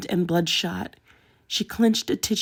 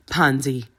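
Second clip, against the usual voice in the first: low warning; 4 semitones lower, 3 dB louder than usual. A narrator's reading. Theo restrains Pansy with a low warning. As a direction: warn her gently but firmly. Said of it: "a warning in his eyes".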